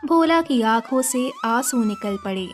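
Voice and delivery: plain reading voice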